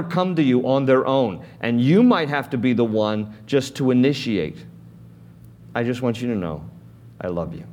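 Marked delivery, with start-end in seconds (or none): 5.7-7.6 soft and much quieter on "I love you"; tender reassurance. Acted. Speaking to an estranged friend, soft and tender. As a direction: gently open the door to make peace. Reaching out to someone who wronged.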